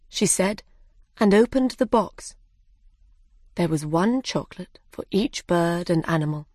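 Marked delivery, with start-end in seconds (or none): none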